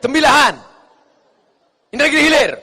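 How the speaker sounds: loud, short, forceful answer; brusque, unsweetened voice. Shown as a demonstration. Preacher shows the proper phone answer, loud and brusque. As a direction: shout the place name with no lilt. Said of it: "Suara aja yang normal".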